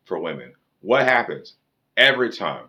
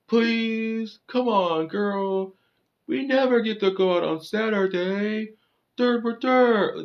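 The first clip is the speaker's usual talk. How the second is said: very high whiny falsetto, imitating a pleading man; mocking whine; slower than usual. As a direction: beg in a squeaky, mock-whiny voice.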